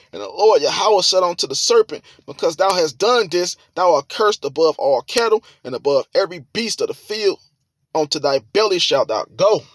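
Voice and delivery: high, forceful scripture preaching. Acted. Preacher voices the Lord questioning Adam, forceful. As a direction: lift it and drive the verses home.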